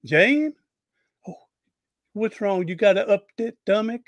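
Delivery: high, sweet nurse voice dropping her S's; imitating the nurse. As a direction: mimic a kind nurse who can't say her S's. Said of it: "she goes".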